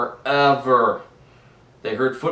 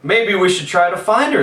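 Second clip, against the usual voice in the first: put-on eager kids; 8 semitones higher, 9 dB louder than usual. A narrator's reading.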